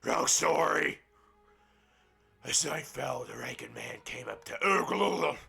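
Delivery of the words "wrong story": "Wrong story!" called out louder and higher; louder repeat of the correction. Real, not acted. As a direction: shout the correction. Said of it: "I'm sorry".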